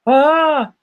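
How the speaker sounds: high mock swooning "oh"; hammed-up high voice of a cartoon woman; imitated Chinese woman. Viewer retells a Family Guy love gag, hammed-up Chinese woman. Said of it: "a Chinese woman".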